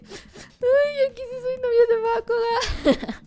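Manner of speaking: very high, drawn-out mock fangirl squeal; swooning fangirl. Host mimics herself watching anime couple videos, swooning fangirl. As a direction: gush in a put-on high, lovestruck voice.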